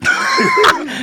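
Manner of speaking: shrieking burst of laughter; loud high laugh. Real, not acted. Big laugh.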